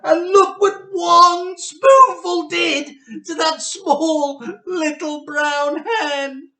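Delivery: high, loud crying out, slow at the end; excited shout. Acted. Child shouting that one spoonful worked wonders, loud and high. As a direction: shout it out, stretching each word.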